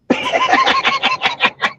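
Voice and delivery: high-pitched burst of giggling; real laughter. Real, not acted. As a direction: crack up at your own story. Cracks up at own story.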